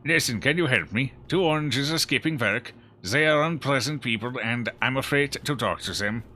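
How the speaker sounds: put-on Scandinavian accent. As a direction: redo the line in a hammed-up Scandinavian accent. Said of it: "strong Scandinavian accent".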